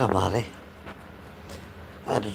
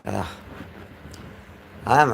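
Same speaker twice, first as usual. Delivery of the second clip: usual speaking voice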